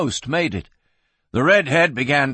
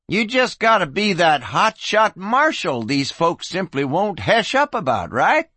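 higher-pitched, taunting challenge; mocking; 6 semitones higher than usual. A narrator's reading. Young gunman taunting a marshal at the poker table, mocking. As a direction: needle him with a mocking question. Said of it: "the source of the mocking remark".

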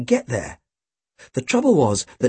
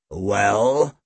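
low, drawn-out rising question; demanding; 6 semitones lower than usual. A narrator's reading. Chief facing the boys in the alley, demanding. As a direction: press for an answer with one word. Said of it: "Snape demanded".

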